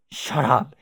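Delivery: a sharp, loud shut up; angry snap. Acted. Jane snapping at rambling Jake, loud and angry. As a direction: cut him off hard.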